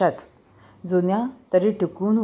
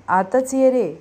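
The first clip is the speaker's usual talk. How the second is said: calling out; 6 semitones higher, 4 dB louder, slower than usual. Acted. Grandma calling from the kitchen.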